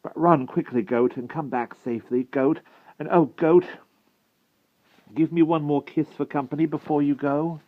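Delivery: high, anxious, pleading farewell; broken voice. A narrator's reading. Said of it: "said the wart in a broken voice".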